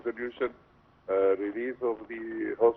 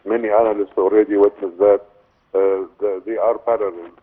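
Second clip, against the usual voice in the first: louder, raised voice over the phone; speaking up; 12 dB louder than usual. Real, not acted. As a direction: project the voice so the caller hears. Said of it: "Could you please speak up".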